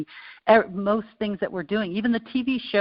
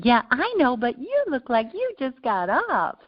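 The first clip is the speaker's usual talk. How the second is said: higher, slower mock voice of the neighbor; imitating the neighbor's jab; teasing neighbor; 8 semitones higher than usual. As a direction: needle her like the smug neighbor. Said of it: "he'd say".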